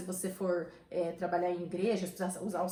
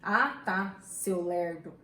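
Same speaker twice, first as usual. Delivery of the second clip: mildly dismissive example, louder opening; a touch of household scorn; mock contempt; 4 semitones lower, 3 dB louder, much slower than usual. Shown as a demonstration. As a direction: brush someone off, half-playfully.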